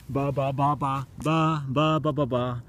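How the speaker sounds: a rising string of light baas; bleating like a sheep. Pretend. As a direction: keep bleating like a lamb. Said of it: "I can try sheep".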